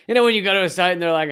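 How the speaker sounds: ordinary delivery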